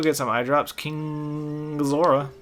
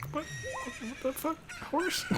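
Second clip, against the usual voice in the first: high squeaky disbelief, much higher; startled; 13 dB quieter, much slower than usual. Real, not acted. Player shocked by the horse mid-story, surprised high yelp.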